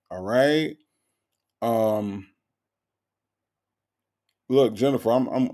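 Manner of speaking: normal talking voice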